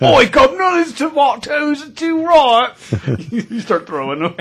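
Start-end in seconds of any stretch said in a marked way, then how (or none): 0.0-2.8 high, loud hammed-up Cockney. Teasing the dad in a high, loud mock Cockney. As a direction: squeal it like a cartoon Cockney. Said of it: "you go straight Cockney".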